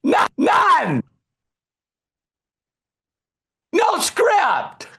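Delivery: high, loud shouted punchline; excited shout. Real, not acted. Speaker reveals to the crowd that there was no script, shouting.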